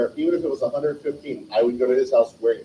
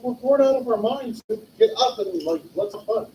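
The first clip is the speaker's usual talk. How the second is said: put-on whiny bored kid; 10 semitones higher than usual. Kids waking a sleeping friend.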